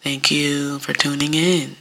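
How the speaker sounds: slow, low, soft and droopy; Droopy Dog. Retold or imitated. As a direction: droop through a listless podcast sign-off. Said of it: "sounding like Droopy".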